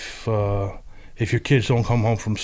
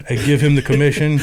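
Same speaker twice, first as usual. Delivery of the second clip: laughing through it; 4 semitones higher, 5 dB louder than usual. Real, not acted. Punchline of a funny story.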